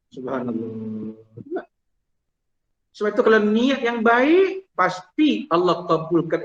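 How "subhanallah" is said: slow, soft and low Subhanallah; quiet, drawn-out praise; awed murmur. Real, not acted. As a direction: breathe out Subhanallah in wonder. Marvelling at the story.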